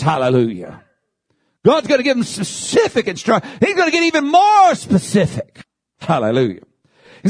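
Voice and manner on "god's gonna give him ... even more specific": high-pitched, driving sermon delivery; impassioned preaching. Real, not acted. Preacher urging the congregation on God's mission, impassioned high preaching. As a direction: preach it with rising fervor, pitch held high.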